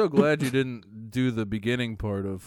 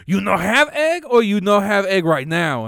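louder, higher broken-English question; mock customer; 7 semitones higher, 7 dB louder than usual. Customer pressing about eggs, a milder mock accent. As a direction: push the question in his put-on accent. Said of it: "he's like".

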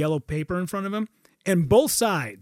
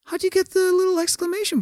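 lightly voiced wide-eyed wonder, higher; a hint of curious Dana; 12 semitones higher than usual. Dana Carvey marveling at the graphic, mild wonder. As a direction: ask like a delighted kid.

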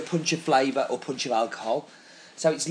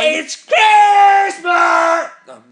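loud; 12 semitones higher, 10 dB louder, much slower than usual. Pretend. Mock festive outburst.

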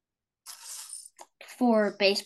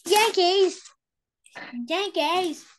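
high-pitched; 7 semitones higher, slower than usual. Real, not acted. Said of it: "your voice went so high".

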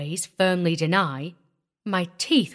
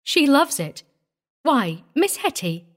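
put-on higher, lively rural young woman; pleasant country mother; 7 semitones higher than usual. A narrator's reading. Young mother chats to Miss Hetty, pleasantly. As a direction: chat on, untroubled.

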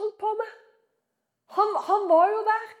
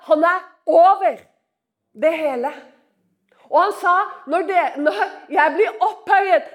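loud, ringing proclamation; full-force preaching; 6 dB louder than usual. Real, not acted. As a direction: proclaim it to the whole room. (kraftfull forkynnelse)

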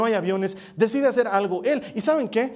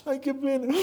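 mock shallow pity; 5 semitones higher, 4 dB quieter than usual. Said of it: "Esto no fue un llanto de".

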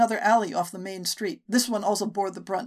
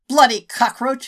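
loud, higher, spat out in fury; spat insult; 4 semitones higher, 10 dB louder than usual. Acted. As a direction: spit the insult as you lunge. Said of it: "he spat".

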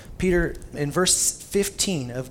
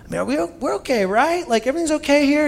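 nervous checking; 10 semitones higher, 4 dB louder than usual. Retold or imitated. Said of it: "walking on eggshells and feeling like".

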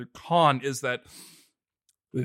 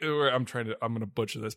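ordinary talk

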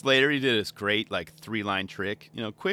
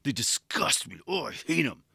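a lightly voiced disgusted hater; mock hater. Viewer who hates Disorder, lightly voiced disgust. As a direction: sneer it like a grumpy fan. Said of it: "You're like".

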